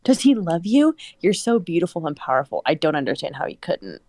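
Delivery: plain reading voice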